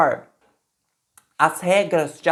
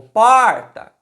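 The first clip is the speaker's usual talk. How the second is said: "porta" called loud and drawn out, like a person's name; calling out; 3 semitones higher, 7 dB louder than usual. Shown as a demonstration. Teacher calling the word as a person's name, loud drawn-out call. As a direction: shout for someone named "porta", stretching the "por". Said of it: "Podemos até gritar, chamar a palavra".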